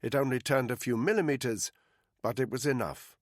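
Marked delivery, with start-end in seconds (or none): none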